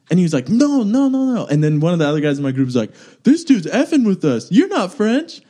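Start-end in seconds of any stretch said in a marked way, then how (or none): 0.5-1.4 high-pitched protest in mock French accent; fake French accent. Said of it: "it was like my French accent now". 3.2-5.4 high-pitched mock outrage; indignant accusation. Retold friend accusing the fake Frenchman.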